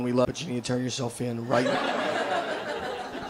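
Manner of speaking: slow, solemn plea from a parent on the news; news appeal parent. Retold or imitated. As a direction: plead into the camera, slow and grave, lightly voiced. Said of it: "I will be on the news going".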